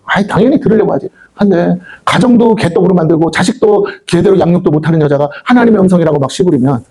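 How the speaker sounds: ordinary talk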